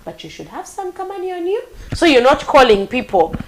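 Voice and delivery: usual speaking voice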